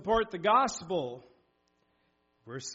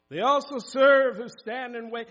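loud; 5 semitones higher, 9 dB louder than usual. Shown as a demonstration. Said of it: "quote repeated with force".

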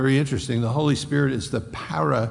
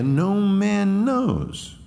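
mocking; 8 semitones higher, slower than usual. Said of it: "when Christ comes".

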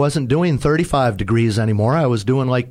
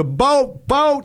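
high, urgent repeated call; captain's sharp high warning; 13 semitones higher than usual. Retold or imitated. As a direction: alert him to a boat ahead. Captain calling out a boat.

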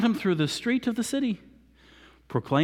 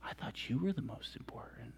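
mock whisper; 7 semitones lower, 16 dB quieter than usual. Teasing Haman in the story.

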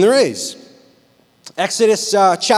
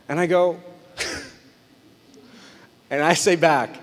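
ordinary talk

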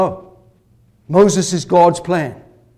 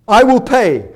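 louder, higher bold pledge; eager promise; 4 semitones higher, 6 dB louder than usual. Retold or imitated. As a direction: dangle the money proudly. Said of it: "Haman's promise".

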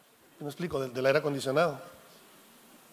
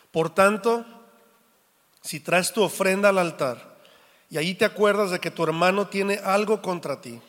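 louder, measured Bible reading; a pastor reading Matthew aloud to the church, solemn and louder; scripture reading; 5 dB louder than usual. Acted. Reads Mateo 5 aloud.

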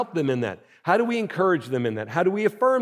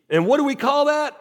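high-pitched mock drill call; mock coach question; 8 semitones higher than usual. Pastor to fatigued churchgoer, high mock coach call. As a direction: pretend to quiz a squad, pitched up.

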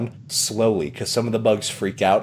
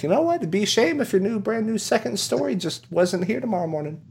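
high, veiled-threat salesman voice; Tom Nook; 10 semitones higher, 3 dB quieter than usual. Acted. Salesman threatening the second story, high menace. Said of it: "And he's like".

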